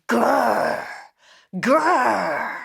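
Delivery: a mild growl like a tiger. Shown as a demonstration. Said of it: "A tiger goes like this".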